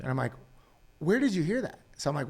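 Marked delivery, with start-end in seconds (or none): 1.0-1.8 surprised question. Retold or imitated. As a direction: pitch jumps up, half-incredulous retold question. Retold surprised reply to son.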